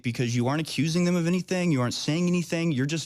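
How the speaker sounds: ordinary talk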